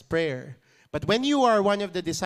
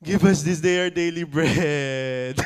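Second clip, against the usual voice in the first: pleading bread line, louder, laughing edge; louder mock plea; 5 dB louder than usual. Shown as a demonstration. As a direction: ask for bread like you mean it. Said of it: "that's how real it is".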